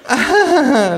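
high, forced hostess laugh; artificial laugh. Acted. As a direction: fake a polite laugh. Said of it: "lacht die Frau künstlich". (gekünsteltes, hohes Lachen der Gastgeberin)

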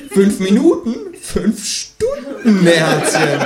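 Känguru taunts the survey caller, high and slow; high, drawn-out mocking kangaroo. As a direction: stretch it out slowly, high and teasing.

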